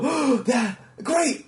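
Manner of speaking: lightly voiced audience perking up; mock delighted crowd. Audience suddenly loving the bit, mock delight.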